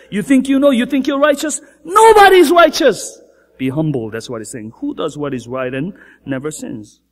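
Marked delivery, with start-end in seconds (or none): 0.1-3.2 higher and louder, peaking on Nobody's; building preacher's rebuke; rising rebuke. Acted. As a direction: challenge the proud hearers. Rhetorical challenge.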